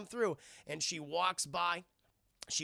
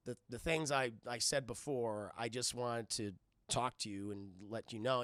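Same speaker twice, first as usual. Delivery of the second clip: low, hesitant earnest voice; acted bashful low voice; awkward confession; 7 semitones lower, 4 dB quieter than usual. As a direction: stumble softly through it, pitched low.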